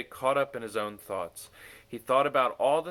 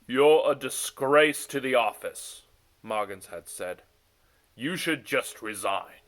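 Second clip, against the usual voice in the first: contemptuous insult; 7 dB louder than usual. A narrator's reading. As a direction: spit out the insult. Moggins insults Smuggins.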